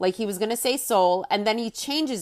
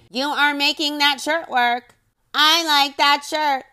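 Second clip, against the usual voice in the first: robot voice; 6 semitones higher, 4 dB louder than usual. Retold or imitated. Wife mocking her husband's compliment in robot voice. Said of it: "she does a robot voice".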